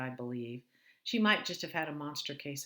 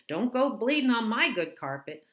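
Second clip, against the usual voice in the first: louder, higher snapping mother; harsh mother; 8 semitones higher, 11 dB louder than usual. Retold or imitated. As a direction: bark it like an impatient mother. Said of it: "she'd point toward the bathroom and say".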